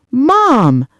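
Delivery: high, loud cry of protest; pained protest. A narrator's reading. Son flinches at his mother's reproach, pained protest.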